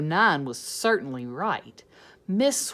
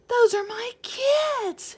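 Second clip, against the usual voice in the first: much higher, slow scratchy voice; 7 dB louder, slower than usual. Acted. Teacher spotting her class, scratchy and slow. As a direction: scratch it out like an old woman. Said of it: "she said in a scratchy voice".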